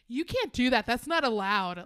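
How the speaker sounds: loud outraged protest at the screen; indignant shout. Retold or imitated. Heckling a chess player on TV, loud indignant shout. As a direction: yell it like the rules were broken. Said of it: "Like, that was me".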